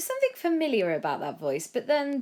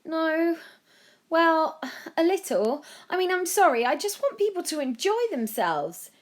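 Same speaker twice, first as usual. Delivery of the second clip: higher, louder flustered explaining; flustered boy; 12 semitones higher, 6 dB louder than usual. A narrator's reading. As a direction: stumble through an apology. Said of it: "Joe tried to explain".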